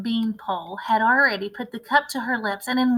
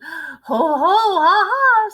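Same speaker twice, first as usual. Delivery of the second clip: higher, louder mocking laugh; gloating cackle; 8 semitones higher, 10 dB louder, much slower than usual. A narrator's reading. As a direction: cackle as if catching him out.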